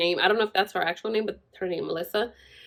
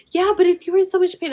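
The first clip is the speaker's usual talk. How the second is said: mild mock mom argument; 9 semitones higher, 5 dB louder, faster than usual. Mom arguing back.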